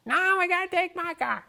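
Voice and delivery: high, whiny insistence; mock Lou digging his heels in; Lou insisting. Narrator imitates Lou refusing his car, high and whiny.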